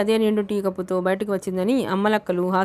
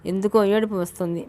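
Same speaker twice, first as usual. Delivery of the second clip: plain reading voice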